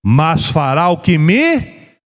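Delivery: very slow, emphatic declamation; drawn-out, deliberate pulpit delivery; solemn scripture proclamation. Acted. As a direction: proclaim it phrase by phrase, with weighty pauses. Quotes scripture aloud.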